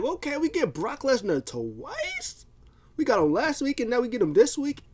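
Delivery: high incredulous excitement, acted out; amazed fan reaction. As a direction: squeal it like a thrilled fan getting a surprise bonus. Retelling own amazed fan reaction.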